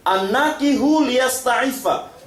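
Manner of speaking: slow, high chanted hadith; Arabic recitation. Acted. Preacher chants the hadith to the youth, slow and high. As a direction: intone the Arabic slowly.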